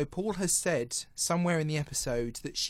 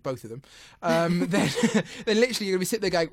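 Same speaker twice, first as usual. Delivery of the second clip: ordinary talk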